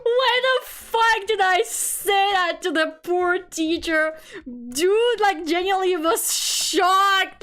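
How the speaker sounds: high-pitched, drawn-out exclamation after laughing; mortified disbelief. Real, not acted. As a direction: cry out, appalled at yourself and amused. Mortified, just after laughing fit.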